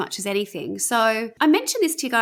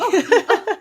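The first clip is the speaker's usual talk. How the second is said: high laugh; 12 semitones higher, 6 dB louder than usual. Real, not acted. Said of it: "Oh, my Lord".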